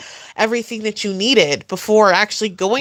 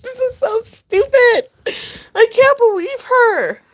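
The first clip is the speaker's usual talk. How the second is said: laughing disbelief; 13 semitones higher than usual. Real, not acted.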